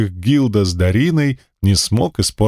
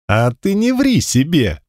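sharp retort; 6 semitones higher than usual. A narrator's reading. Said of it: "неожиданно резко отреагировал".

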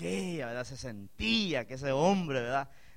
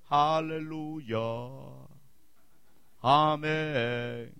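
mock liturgy; 4 dB louder, much slower than usual. Mocking Latin mass liturgy.

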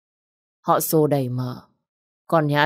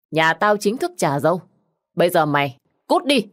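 loud scolding; 3 semitones higher, 3 dB louder than usual. Acted. Old aunt bellows at the widow to get out. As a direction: bellow her out. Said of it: "lúc này quát lớn".

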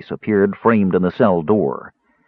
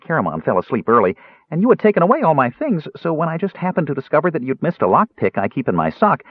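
high, quick, guileless chatter; chatty Kender prisoner; 8 semitones higher than usual. Acted. Kender explaining himself to the jailer, chatty. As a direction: rattle on blithely in a high voice.

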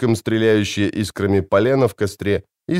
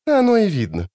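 snorting scornfully; 10 semitones higher than usual. A narrator's reading. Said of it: "фыркнула Мэва".

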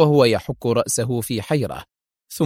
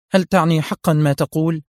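higher-pitched, disbelieving question; stunned cry; 5 semitones higher, 3 dB louder than usual. A narrator's reading. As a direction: demand confirmation in shock. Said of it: "صرخت منى في ذهول". (سؤال مذهول)

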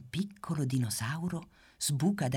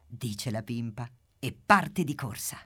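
usual speaking voice

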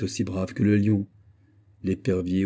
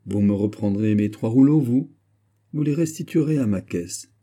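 ordinary delivery

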